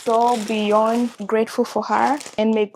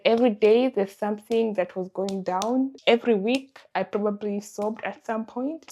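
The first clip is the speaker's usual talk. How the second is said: normal talking voice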